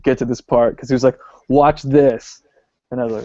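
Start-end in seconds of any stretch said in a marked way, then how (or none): 1.5-2.4 mildly excited, imitating an eager dad; a touch louder, eager to show it off; stoked. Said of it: "my dad was so stoked".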